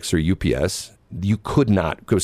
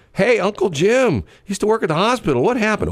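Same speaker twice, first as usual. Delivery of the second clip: put-on curious kid voice; 13 semitones higher than usual. Grandkid asking about the uncle.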